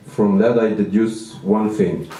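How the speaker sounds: ordinary talk, nothing marked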